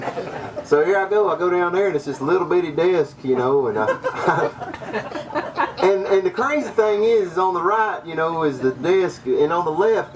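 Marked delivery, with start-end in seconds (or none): 3.9-5.8 breathless high giggle; can't hold back a giggle at the memory; airy high laugh. Real, not acted. Teller laughs at own story.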